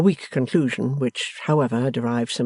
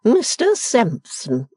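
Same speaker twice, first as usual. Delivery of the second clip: slow, high, lofty scorn; scornful matron; 9 semitones higher, 4 dB louder, much slower than usual. Acted. Mother retorts to daughter, slow lofty scorn. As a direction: look down on her daughter. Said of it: "she began".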